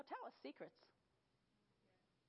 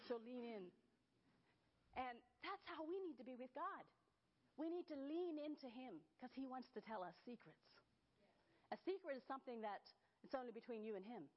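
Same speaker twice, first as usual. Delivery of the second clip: usual speaking voice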